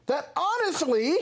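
high, drawn-out mock TikTok voice; exaggerated character voice. Imitating videos that trip him out, exaggerated high character voice. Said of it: "when you talk like that".

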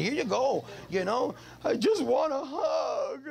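imitating his crying wife begging for a hug; mock crying wife. Wife crying, voiced in story.

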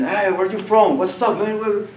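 lightly voiced confrontational tough guys; acted bullies. Actor playing bully accosts small kid on stage, mock challenge.

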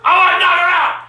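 furious yelled refusal; real angry outburst; enraged shouting. Real, not acted. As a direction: shout down the one calming you. Enraged threats during the quarrel.

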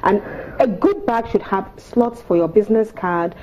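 ordinary talk

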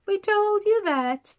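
very high, loud taunt; loud high told-you-so; mocking neighbors. A narrator's reading. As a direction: jeer it like gloating neighbors. Neighbors mocking Mister Tusker.

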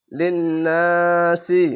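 লিন্নাসি, slow, lingering on n and "naa"; wajib ghunna. Shown as a demonstration. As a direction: hold the nn of লিন্নাসি in a nasal hum. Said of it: "ওয়াজিব গুন্না হচ্ছে".

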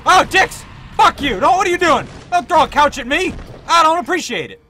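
loud, high-pitched indignant outburst; alarmed yelling. Real, not acted. Player has a couch thrown at him, indignant yelling. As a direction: shout in startled protest.

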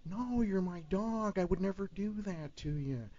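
high, soft, drawn-out surfer-buddy voice; Owen Wilson impression. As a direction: defend yourself softly like laid-back Owen. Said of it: "Owen would be like".

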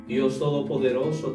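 ordinary delivery

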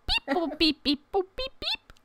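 imitated robot beeps and boops; squeaky mock robot chatter; high robot beeping. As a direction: beep in short, high-pitched robot chirps. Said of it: "He starts clicking and beeping at you".

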